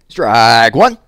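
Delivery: loud, bellowing umpire call; umpire's call. A narrator's reading. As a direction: shout it across the field. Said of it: "cried the umpire".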